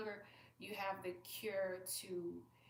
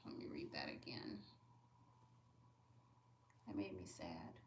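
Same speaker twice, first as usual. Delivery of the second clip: whispering; 14 semitones lower, 11 dB quieter than usual. Real, not acted. Quietly rereading a sad comment.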